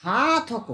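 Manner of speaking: "হা" held long in "হাতক"; drawn-out syllable. Shown as a demonstration. Teacher pointing out the stretch, "হা" drawn out. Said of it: "টানছি এখানে".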